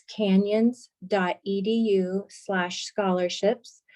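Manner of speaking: slow, careful dictation of a web address; unhurried, spaced-out read for note-takers. Real, not acted. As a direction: spell out the link slowly, piece by piece. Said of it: "I'll say it slowly".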